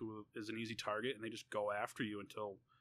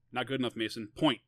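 louder, insistent order; stern command; 10 dB louder than usual. A narrator's reading. Older sibling ordering brother to name the bully, stern command. Narrator confronts brother in story.

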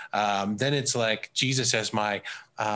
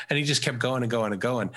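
ordinary delivery

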